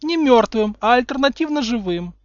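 high, matter-of-fact prim voice; absent correction. A narrator's reading. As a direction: correct him distractedly. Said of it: "рассеянно поправила Гермиона". (рассеянно поправляет)

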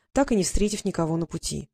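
ordinary delivery, nothing marked

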